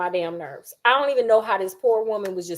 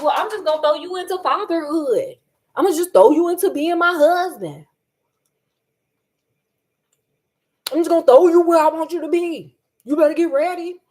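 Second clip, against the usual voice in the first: mock threat back; 5 semitones higher, 5 dB louder than usual. Imagined Ingrid mocking Brian.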